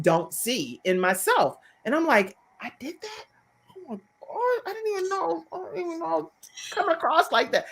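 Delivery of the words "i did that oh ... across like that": high, hushed disbelief; small, high voice of someone taken aback; shocked realization. Retold or imitated. Host replays her reaction to mom's feedback, hushed shock.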